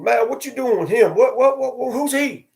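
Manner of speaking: put-on high, fast kid voices piling questions; excited kids. Kids asking about the man with the boy, high excited voices. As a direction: pitch it up like nosy kids.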